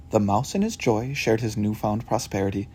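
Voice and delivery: ordinary delivery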